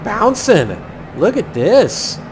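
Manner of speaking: excited. Real, not acted. Turbulence shakes the plane.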